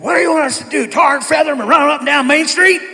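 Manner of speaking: put-on big old dad exploding in a staff meeting; frustrated outburst. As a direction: blow up in frustration at the room. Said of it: "he just got so frustrated".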